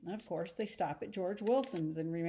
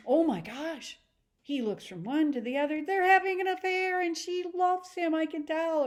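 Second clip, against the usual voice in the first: high, loud voice of Tom realizing the affair; shocked inner thought; 9 semitones higher, 6 dB louder than usual. Acted. Tom watching Daisy and Gatsby, loud and high with shock. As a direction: gasp it out as the penny drops. Tom silently reading the lovers.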